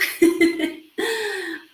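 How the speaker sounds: high bright burst of laughter; self-conscious laugh. Real, not acted. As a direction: laugh at yourself. Laughing at her own fast talk.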